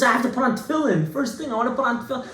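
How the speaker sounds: high, fast, excited imitation; breathlessly eager mock outburst; going bananas. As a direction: act out an overjoyed convert rushing his words. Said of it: "he was going bananas".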